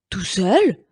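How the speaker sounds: stunned question; astonished exclaim. Acted. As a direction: gasp it out in chorus. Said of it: "s'exclament tous les autres".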